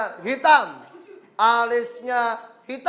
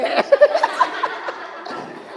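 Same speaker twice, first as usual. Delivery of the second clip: high unvoiced laugh. Real, not acted. Teacher laughing at own joke.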